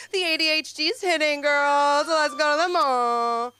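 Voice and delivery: put-on high, loud, excited valley girl rallying; hyped girly voice. Joking about her ADHD, loud girly hype. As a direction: cheer the girls on like a mall trip. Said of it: "And I'm like".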